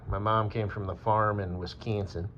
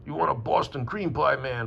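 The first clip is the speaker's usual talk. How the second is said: Boston accent; 4 semitones higher, slower than usual. Shown as a demonstration. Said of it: "Boston is a little bit more".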